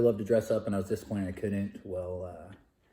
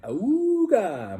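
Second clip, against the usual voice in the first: cartoon ooga cry; 12 semitones higher, 10 dB louder than usual. Cartoon love-struck reaction.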